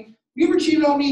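the question shot out loud and fast; accusing spouse. Shown as a demonstration. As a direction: hurl it like a suspicious spouse. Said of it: "Right, a spouse or somebody".

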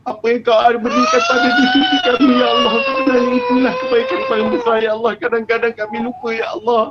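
fervent doa, voice cracking into sobs; tearful prayer. Real, not acted. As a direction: plead with God, near tears. Leads a tearful closing prayer. (doa penuh sebak dan rayuan)

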